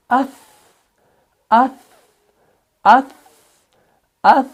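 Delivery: "अस" ending in a faint lisped "th" with air; soft airy hams. Shown as a demonstration. Teacher showing air escaping with sa, softly released "अस". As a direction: let a little breath escape after "अस". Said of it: "थोड़ी सी हवा निकल रही है".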